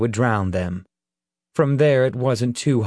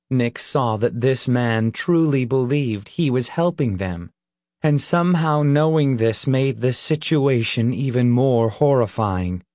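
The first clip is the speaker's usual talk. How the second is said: ordinary delivery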